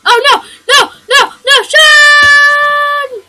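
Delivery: loud laughing yell rising high; panicked shout. Real, not acted. Gamer watches his son fall, shouting the name loud. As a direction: shriek the name in mock panic.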